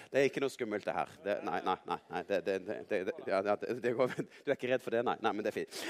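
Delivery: low, quick, reassuring murmur; calm their nerves in a quick low voice; low reassurance. Real, not acted. Telling a listener it is nothing scary, low and reassuring. Reassures someone nervous about the story.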